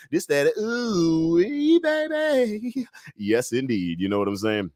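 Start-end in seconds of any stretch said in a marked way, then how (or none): 0.1-2.9 slow, rising, higher ooh-wee; savoring drawl. Retold or imitated. Bragging about the flaming drink, slow high ooh-wee. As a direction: stretch it out relishing the drink. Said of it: "I was like".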